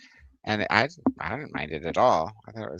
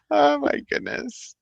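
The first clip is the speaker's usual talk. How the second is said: laughing disbelief; 5 dB louder, slower than usual. Real, not acted. Laughing at own all-night stream.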